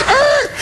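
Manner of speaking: very high imitated tire squeal; screeching brakes. Storyteller mimicking the limo's sudden stop, high screech. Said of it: "he slams on the brakes".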